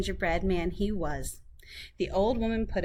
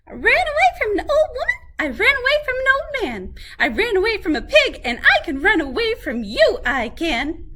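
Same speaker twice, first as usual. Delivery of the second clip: very high, fast bragging; boasting gingerbread man; 13 semitones higher, 6 dB louder than usual. A narrator's reading. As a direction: rattle off the boast.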